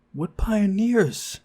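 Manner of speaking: incredulous shout. Acted. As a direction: shout the question in disbelief. Incredulous outburst at claim.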